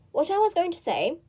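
high-pitched, offended protest; resume with wounded dignity. A narrator's reading. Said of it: "said the Dodo in an offended tone".

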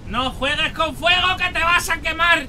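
high, loud cry of warning; shouted warning. Real, not acted. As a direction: yell the warning at full pitch. Repite el aviso gritando. (advertencia gritada en tono agudo)

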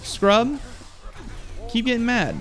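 usual speaking voice

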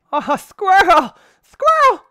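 very high, loud squealing; excited squeal. Real, not acted. Gamer squealing at a squirrel he spots. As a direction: shriek it with giddy delight.

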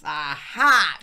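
loud, gotcha exclamation; old woman pounces with a loud aha; triumphant aha. Acted. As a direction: catch the thief out loud. Old woman catches the robber.

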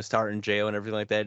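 ordinary delivery

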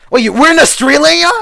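high, loud, astonished; surprised realization. Real, not acted. Streamer realizing the server is Australian, astonished. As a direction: jump up in disbelief.

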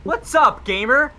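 squeaky mock greeting; high silly voice. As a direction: pitch way up and tease.

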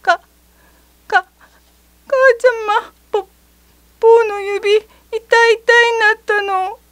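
put-on very high, sobbing little boy voice, stammering; crying child. Little boy sobbing to his mother about his sore finger. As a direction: blubber it out between sobs like a small child. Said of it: "泣きながらいいするのですから".

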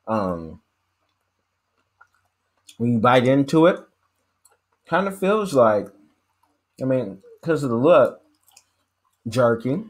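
chewing tough dried meat; talking while chewing. Real, not acted. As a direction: mouth partly full of jerky. Seen 0: holds jerky, bites it at 7.5, chewing through 9.8.

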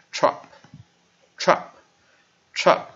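"Trop" as one short, loud syllable; syllable drill. Shown as a demonstration. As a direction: punch "trop" three times with long gaps. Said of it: "所以我们念什么".

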